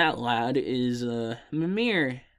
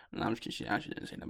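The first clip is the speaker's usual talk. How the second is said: quick whisper; 4 semitones lower, 12 dB quieter, much faster than usual. Real, not acted. Quick aside taking back joke.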